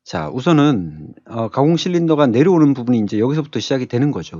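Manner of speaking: usual speaking voice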